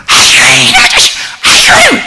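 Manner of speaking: very high, put-on yelps; mock loud wailing. Child being swatted with a broom, mock wailing. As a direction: imitate noisy cries during a harmless broom beating. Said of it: "소리는 엄청 커근데".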